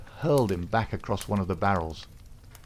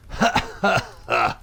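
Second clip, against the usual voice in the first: big booming laugh, higher and louder; roaring laughter; 5 semitones higher, 6 dB louder, slower than usual. A narrator's reading. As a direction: burst out laughing. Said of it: "burst into a roar of laughter".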